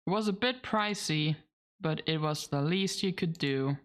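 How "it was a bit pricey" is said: ordinary talk, nothing marked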